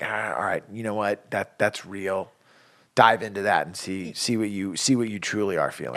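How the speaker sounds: put-on dad gently encouraging a sad child; gentle encouragement. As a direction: softly invite the kid into the feeling. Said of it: "I've always been like".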